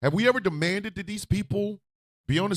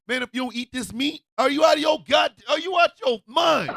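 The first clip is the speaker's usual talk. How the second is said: incredulous shout; 6 semitones higher than usual. Real, not acted. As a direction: shout in disbelief. Incredulous rant.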